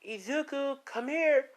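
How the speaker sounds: high-pitched call to someone in the house. Retold or imitated. Calling someone to the door.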